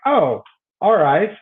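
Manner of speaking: retold reaction, drawn out as it clicks; dawning realization. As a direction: draw it out knowingly. Retold reaction to client's divorce news.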